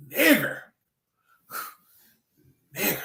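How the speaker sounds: high-pitched mock, dragged up from the feet. Shown as a demonstration. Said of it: "it's like it come from their feet".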